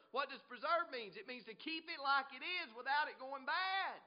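high, loud explanatory preaching; forceful preaching. Real, not acted. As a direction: press the definition on the congregation.

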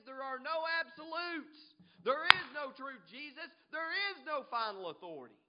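loud, high, hammered denial; scoffing. Acted. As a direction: declare it defiantly as a scoffer. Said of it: "He was scoffing".

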